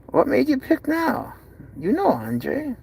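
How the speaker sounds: high-pitched doting question; cooing pet talk. Real, not acted. Owner asks cat why it hissed, high cooing. Asking the cat about its hiss.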